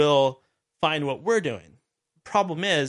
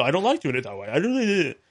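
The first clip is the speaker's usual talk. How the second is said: mock Canadian accent, rising at the end; goofy Canadian accent; 3 semitones higher than usual. Host mimics guest refusing to start now, goofy Canadian accent. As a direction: imitate a stubborn guest in silly Canadian. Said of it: "he says in his goofy Canadian accent".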